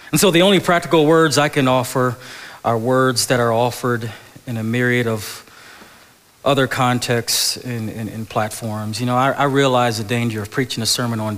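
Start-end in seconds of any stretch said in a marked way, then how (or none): none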